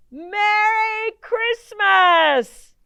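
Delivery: very loud, high, drawn-out shout. Acted. Conductor shouting from the departing train. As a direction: call it across the distance. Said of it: "he shouted".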